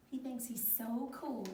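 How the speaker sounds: ordinary delivery, nothing marked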